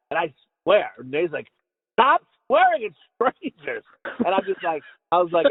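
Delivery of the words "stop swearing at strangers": slow, higher-pitched imitation of an exasperated companion; mock scolding. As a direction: drag out the reprimand, word by word. Retold companion scolding him.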